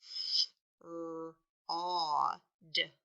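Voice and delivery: fraud split into "F-, r-, aw, d", each sound alone; "F-, r-, aw, d" as separate phonics sounds, aw held. Shown as a demonstration. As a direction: tap out "F-, r-, aw, d" with gaps. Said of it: "tap out the sounds we hear".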